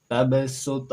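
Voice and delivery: Tabasut with the b and t bouncing; bouncing letters. Shown as a demonstration. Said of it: "memiliki suara memantul".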